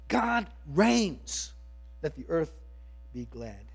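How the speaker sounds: slow, lifted reading of a hymn verse; hymn recitation. Acted. Pastor recites Babcock's hymn to the congregation, slow and lifted. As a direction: savour each line like a poem.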